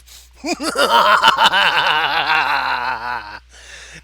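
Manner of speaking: long, very loud villain laugh; insidious laughter. Acted. Villain's laughter breaking out all through the ship, insidious. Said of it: "An insidious laughter suddenly broke out".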